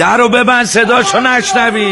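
high, loud, urgent order; exasperated command. Acted. Tailor ordering door shut against the shouting, exasperated command.

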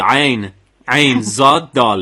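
slow, separated letter names; deliberate spelling with each letter set apart. Shown as a demonstration.

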